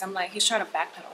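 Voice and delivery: normal talking voice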